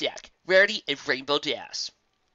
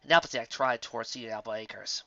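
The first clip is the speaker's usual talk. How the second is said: ordinary delivery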